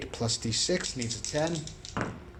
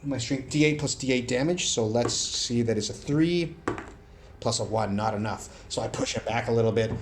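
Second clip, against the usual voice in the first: usual speaking voice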